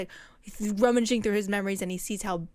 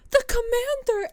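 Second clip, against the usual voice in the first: much higher, louder alarmed shout; mock shocked cry; 14 semitones higher, 6 dB louder than usual. Soldiers crying out at the commander's lost arm, loud and high. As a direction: cry out in horror at the fallen leader. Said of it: "everyone's like".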